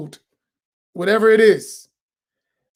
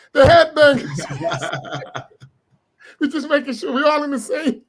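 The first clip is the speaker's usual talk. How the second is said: slow words through laughter; real laughter breaking the words; laughing; 3 semitones higher than usual. Real, not acted. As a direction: laugh while saying it.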